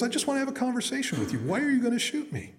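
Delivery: very high, pleading bear voice; talking bear. Acted. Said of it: "And the bear goes".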